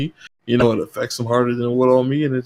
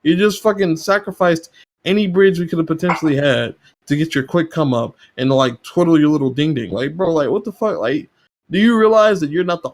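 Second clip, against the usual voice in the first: higher, worked-up ranting; exasperated rant; 5 semitones higher than usual. Real, not acted. Streamer venting at fans who bait him, exasperated and heated. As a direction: vent at the baiters, pitch climbing.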